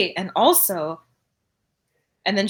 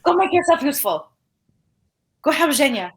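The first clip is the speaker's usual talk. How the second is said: dismissive; 5 semitones higher, faster than usual. Acted. Dismissing him with a hand wave.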